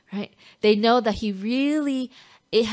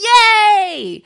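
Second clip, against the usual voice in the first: put-on high, loud child's yay; imitating son cheering; 13 semitones higher, 14 dB louder than usual. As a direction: squeal like a thrilled little boy. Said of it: "where he's like".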